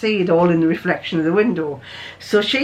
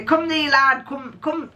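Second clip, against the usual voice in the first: higher-pitched, poorly imitated Yorkshire accent; bad Yorkshire accent; 6 semitones higher, slower than usual. Imitating his lordship's Yorkshire Sherlock, bad Yorkshire accent. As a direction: have a half-hearted go at Yorkshire, fading off. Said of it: "I can't even do his Yorkshire accent".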